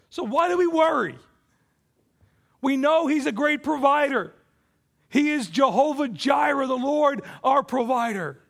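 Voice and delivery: loud, high preaching build; preaching at full force. Acted. Pastor preaching loudly to the church about God's faithfulness. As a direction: preach it out to the congregation.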